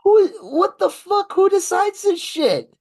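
high, loud, incredulous outburst; exasperated outrage. Real, not acted. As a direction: blurt it out in disbelief. Outraged at Rogan deal.

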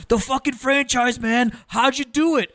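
excited fans. Retold or imitated. Fans praising the promoter.

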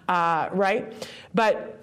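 ordinary talk

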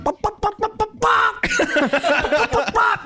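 high, clucking chicken sound. Shown as a demonstration. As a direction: cluck it like a hen. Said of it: "It's gotta be more like".

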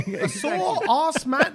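high, very slow drawn-out question; comic incredulous repeat. Real, not acted. Repeating the misheard name to laughing colleagues, high and drawn-out. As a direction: stretch it out high, playing up the laugh.

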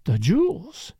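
dreamy, lingering repeat of the words; dreamy wonder. A narrator's reading. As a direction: savour it, lost in thought. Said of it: "Her eyes grew misty, a faraway light".

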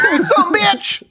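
slow, high hammed-up sob; fake-cry the insult, dragging it out; mock crying. Voicing betrayed Trinity.

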